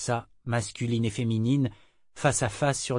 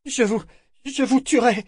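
high, halting, panting vow; breathless threat; 12 semitones higher, 4 dB louder than usual. A narrator's reading. Althea threatens Kénit while passing, breathless. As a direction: force out the threat between breaths. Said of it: "promit-elle haletante".

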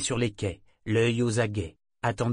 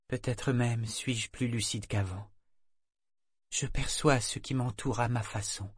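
usual speaking voice

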